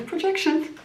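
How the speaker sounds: high sneering put-down; scoffing. Real, not acted. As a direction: toss the word off in a high mocking lilt. Scoffing one-word verdict.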